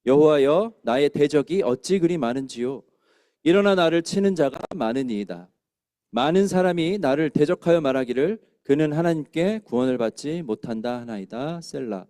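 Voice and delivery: reading scripture aloud together, loud; psalm read in unison; congregational scripture reading. Shown as a demonstration. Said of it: "다시 한번 읽어볼까요".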